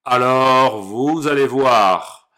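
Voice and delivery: loud furious wolf shout; angry wolf roar. Acted. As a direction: roar the threat. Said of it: "hurla le loup".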